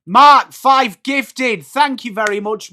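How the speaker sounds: real excitement calling out the name; excited shout-out. Real, not acted. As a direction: really stretch it out to celebrate the gift. Thanking a viewer for gifts.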